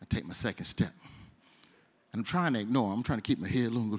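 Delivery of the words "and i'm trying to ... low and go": ordinary talk, nothing marked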